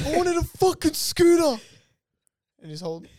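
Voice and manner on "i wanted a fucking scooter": high, loud, drawn-out mock tantrum; tantrum yell. Echoing his friend's scooter quote, loud drawn-out tantrum yell. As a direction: belt it out slow and high like a tantrum.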